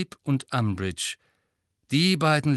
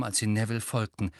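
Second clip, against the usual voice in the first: ordinary delivery, nothing marked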